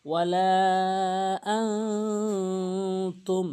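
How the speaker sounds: very slow "আনতুম" with a long nasal hum; nasalised recitation. Shown as a demonstration. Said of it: "নাকের ভিতর নিতে হবে".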